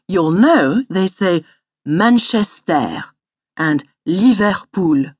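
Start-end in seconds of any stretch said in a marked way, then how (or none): 1.9-3.0 put-on Manchester in a French accent, each syllable stressed equally. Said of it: "If you've heard a French person". 4.1-5.1 put-on Liverpool in a French accent, each syllable stressed equally. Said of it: "If you've heard a French person".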